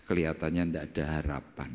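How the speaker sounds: low, soft, hopeless sigh; a tired sick man answering a friend, hopeless; gloomy reply. Pretend. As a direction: sigh it out like there's no hope left. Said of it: "kalau engkau ditanya".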